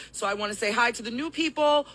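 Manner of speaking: ordinary talk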